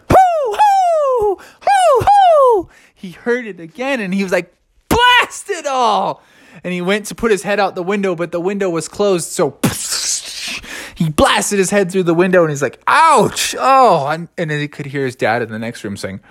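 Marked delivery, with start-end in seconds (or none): 0.1-2.7 high, ringing owl hoots; long falsetto hoo-hoo. A narrator's reading. Owl hooting in a story. 4.9-6.2 slow, high exasperated cry; drawn-out, higher-pitched grumbling outburst. A narrator's reading. Sleepless bear cursing the owl. 12.9-14.1 high, quick cry of pain; story-time "ouch". A narrator's reading. Head through the window.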